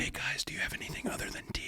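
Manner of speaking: hushed, hurried whisper; barely voiced, furtive whisper. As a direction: whisper it as if hiding in an attic. Imagining Anne Frank's family hiding in attic.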